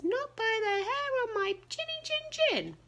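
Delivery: very high piggy squeak; tiny pig squeaking its refusal; squeaky little pig. A narrator's reading. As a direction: pitch way up like a tiny frightened pig. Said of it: "And the little pig says".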